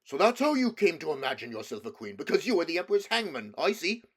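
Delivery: mock her to her face; a furious beaver's jeer; scornful beaver. A narrator's reading. Beaver mocks the witch.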